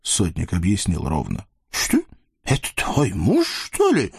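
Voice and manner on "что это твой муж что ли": high, startled eastern sultan voice; surprised sultan. Acted. Sultan asks Nastasya in surprise, high and startled. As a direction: ask in shocked disbelief like a sultan. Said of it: "султан удивлённо спросил".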